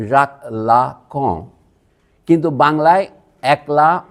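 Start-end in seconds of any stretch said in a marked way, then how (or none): 0.1-1.5 জাক লা কঁ said very slowly with a nasal French ending; deliberate French name, nasal final vowel; French pronunciation. Shown as a demonstration. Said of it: "এই লোকের নাম হচ্ছে".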